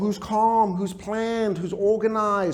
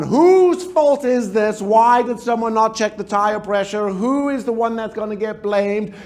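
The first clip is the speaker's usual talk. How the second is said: loud, slow, accusing demands; blaming critical parent; 3 semitones higher, 6 dB louder than usual. Acted. Critical parent yelling at the family by the road, louder. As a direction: bark out blame at everyone. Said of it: "You were out the car blaming everyone".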